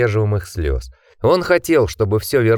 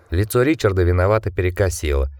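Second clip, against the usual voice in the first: ordinary delivery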